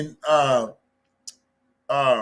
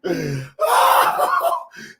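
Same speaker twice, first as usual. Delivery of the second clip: high burst of real laughter; loud laugh; 6 dB louder than usual. Real, not acted. Cracking up at the clip.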